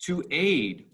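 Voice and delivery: light "To", punched "aid"; weak-strong stress. Shown as a demonstration. Teacher shows students an iamb, loud stress on the second syllable. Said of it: "weak, strong".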